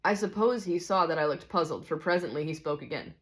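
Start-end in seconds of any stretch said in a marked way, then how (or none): none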